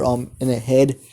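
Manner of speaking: plain reading voice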